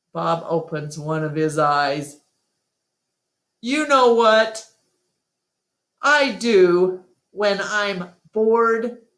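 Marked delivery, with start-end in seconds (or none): 3.6-9.1 higher, slow teasing dog voice; sly Bob voice. Acted. Bob the dog teasing Ruby before going back to sleep. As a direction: drag out the setup lazily.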